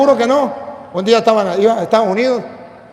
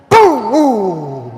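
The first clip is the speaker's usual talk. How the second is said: imitated impact. Shown as a demonstration. Priest voices the word of God hitting him, imitated impact. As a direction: sound out a blow, then sink away.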